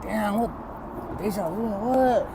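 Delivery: high, slow stunned double take; stunned disbelief. Retold or imitated. To his long-lost brother, stunned and high. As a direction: mouth agape, can't believe it's him. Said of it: "I'm looking at him like".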